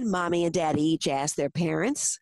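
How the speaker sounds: ordinary delivery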